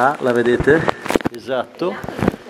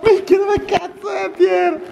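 calling out; 13 semitones higher, slower than usual. Real, not acted. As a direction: yell for him across the cavern. Calling out for Pier in cave.

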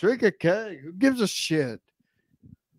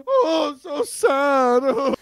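mock crying; 7 semitones higher, much slower than usual. Host mocks guest with fake crying.

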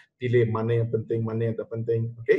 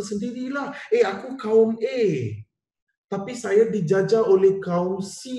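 voicing other nations; 7 semitones higher than usual. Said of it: "Dia kata".

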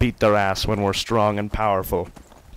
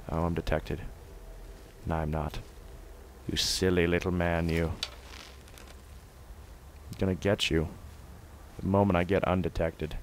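hushed sneaking; 7 dB quieter than usual. Real, not acted. Sneaking in game.

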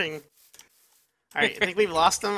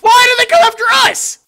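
panicked shout; 13 dB louder than usual. Real, not acted. Being chased.

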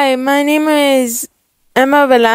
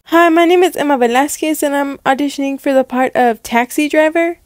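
eager auditioner; 3 semitones higher, faster than usual. Acted. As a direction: introduce yourself hopefully to casting. Repeated auditions, first still eager.

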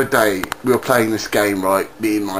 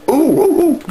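goofy high ooh; 13 semitones higher, 4 dB louder than usual. Acted. Goofy reaction noises.

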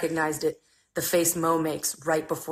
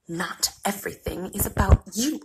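usual speaking voice

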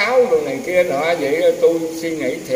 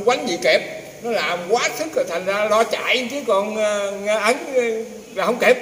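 higher, hurried voice of a scared man; a frightened neighbour blurting out why he ran, in a higher mock panic; retold panic; 5 semitones higher than usual. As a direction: act out the man who fled in fright. Frightened man who fled a ghost.

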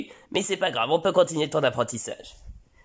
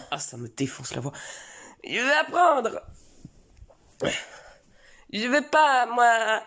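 put-on whiny child; 9 semitones higher than usual. Son insisting to his father he wants to learn, higher whiny kid. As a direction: plead like a stubborn little boy.

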